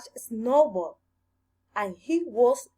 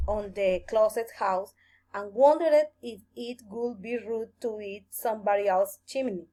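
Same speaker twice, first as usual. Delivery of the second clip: ordinary delivery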